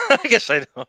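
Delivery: sheepish laugh through the words. Real, not acted. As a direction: laugh it off, caught out. Caught out, laughs.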